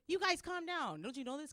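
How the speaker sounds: ordinary talk, nothing marked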